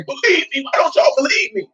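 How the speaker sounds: high, pleading frustration; frustrated plea. Retold or imitated. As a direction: plead like no one's listening. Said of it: "He was frustrated".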